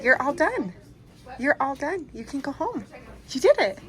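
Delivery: loud, delighted cheering; excited cheering. Real, not acted. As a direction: celebrate that he made it. Cheering him on waking.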